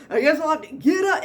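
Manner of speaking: high, loud hammed-up moan of effort; mock a patient straining to leave the chair; groaning reluctant patient. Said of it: "They will literally say stuff like".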